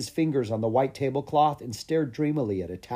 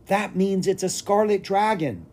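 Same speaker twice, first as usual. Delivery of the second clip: high-pitched, thrilled realization; eager and amazed, pitch raised; excited cry; 7 semitones higher than usual. A narrator's reading. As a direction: blurt out the amazing discovery. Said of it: "Lucas cried".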